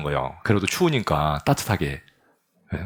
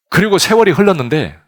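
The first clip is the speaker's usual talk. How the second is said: usual speaking voice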